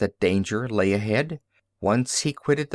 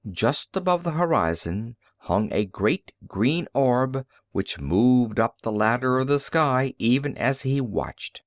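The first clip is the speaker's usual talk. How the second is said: ordinary delivery, nothing marked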